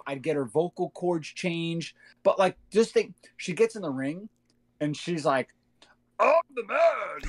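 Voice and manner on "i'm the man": high, slow Kermit-like croak of a wrestler's catchphrase; mock the wrestler as a puppet frog, pitched up and slow; Kermit the Frog impression. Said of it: "it's like a Kermit the Frog type deal".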